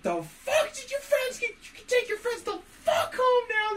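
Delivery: high, shrill screaming mom, voice cracking; mock furious mom shout. Mom screaming at her son's friends to leave. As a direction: yell like an enraged mother throwing the kids out.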